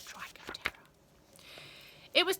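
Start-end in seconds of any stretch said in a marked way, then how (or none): 0.1-0.8 eerie whispered echo; spooky whisper. A narrator's reading. Narrator echoing the nickname to the children, whispered. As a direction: hiss it back like a ghost.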